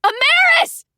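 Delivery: high, loud yell of her name. A narrator's reading. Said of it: "I yelled".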